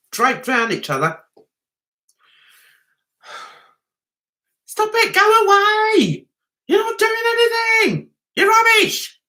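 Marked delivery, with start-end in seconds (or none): 4.7-9.2 mock heckling. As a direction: jeer at him in a high, stretched voice. Heckling the man being mocked.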